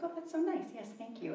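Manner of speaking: high, soft grateful coo; touched thanks. Retold or imitated. Woman accepting a free rose from a cashier, softly delighted.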